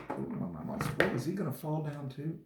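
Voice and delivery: usual speaking voice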